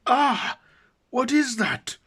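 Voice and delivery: very high, loud yelp; pained outcry. A narrator's reading. Mummy king shot in the hand, shocked yell. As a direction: cry out as if just shot.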